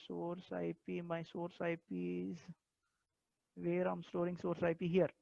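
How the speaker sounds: barely audible, far from the mic; speaking very softly; very quiet. Real, not acted. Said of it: "So now your voice is very low".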